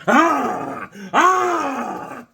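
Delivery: high, loud comic yells of pain; two drawn-out imitated screams; mock tasing scream. Retelling security tasing him on the ship, mock screaming. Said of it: "they started tasing me".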